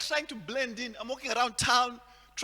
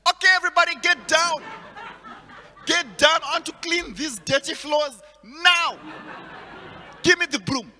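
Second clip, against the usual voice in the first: loud, barking, laughing robbery orders; hammed-up stick-up shout; mock robber; 5 semitones higher, 8 dB louder than usual. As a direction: play a robber holding up the room.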